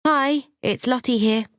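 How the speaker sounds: high, put-on cheerful phone greeting; polite phone voice. A narrator's reading. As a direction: announce yourself lightly on a phone call. Narrator's character talking on the phone.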